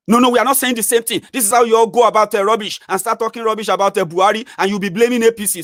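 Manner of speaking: hammed-up furious old man snapping; angry commenter. Angry commenter snapping at the writer, high mock outrage.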